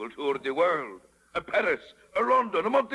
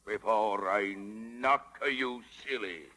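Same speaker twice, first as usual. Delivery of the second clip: lower, softer and slow; low menacing threat; 8 semitones lower, 5 dB quieter, slower than usual. Acted. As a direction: threaten him under the breath. Stromboli threatens Pinocchio.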